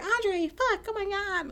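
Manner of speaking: very high, breathy mock moan. Host imitates woman moaning Andre's name in sex scene, hammed-up high moan. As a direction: ham up her moaning. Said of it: "She was just like".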